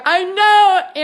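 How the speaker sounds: high, loud, drawn-out agreement; excited agreement. Real, not acted. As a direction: gush right back at an excited fan. Delighted reply to excited viewer.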